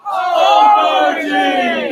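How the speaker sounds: loud shouted reply; crowd shout. Real, not acted. Crowd answering the poem's cue, loud shout. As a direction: shout it back.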